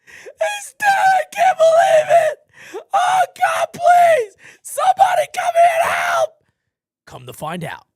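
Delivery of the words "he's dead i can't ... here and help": shrieking falsetto wail, very high and loud; hammed-up high-pitched hysterical screaming; hysterical wife. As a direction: scream for help like a panicked wife. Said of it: "Carol calls up".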